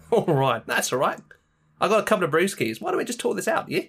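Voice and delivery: matey Aussie peacemaker, pitch climbing; hammed-up easygoing Australian; mock Australian accent. Action hero offers villain a beer to talk, mock Australian. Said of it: "representation of the Australian accent".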